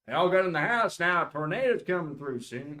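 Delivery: put-on country Southern accent; folksy Southern storm warning. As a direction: warn the household in a Southern accent. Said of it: "do a Southern accent".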